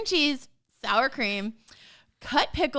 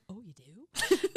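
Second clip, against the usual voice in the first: Abby's softened reply; 2 semitones lower, 3 dB quieter, slower than usual. Retold or imitated. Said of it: "she's like".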